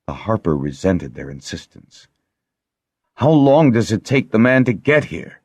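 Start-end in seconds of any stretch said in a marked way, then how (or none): 3.2-5.4 raised, fierce demand, higher and louder. A narrator's reading. Lessa demanding the healer, fierce and raised. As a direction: Lessa snapping with fear for him. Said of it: "sounding fiercer than he'd ever heard her".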